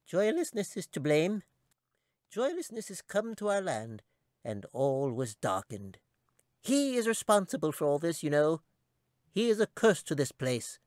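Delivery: high squeaky voice, grave and bitter; tiny creature blaming a villain; squeaky chipmunk. A narrator's reading. Chipmunk explains the curse.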